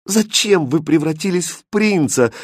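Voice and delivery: high, mildly tearful plea; understated tearful girl voice. A narrator's reading. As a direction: ask with a touch of tears. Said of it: "со слезами на глазах сказала Катринка".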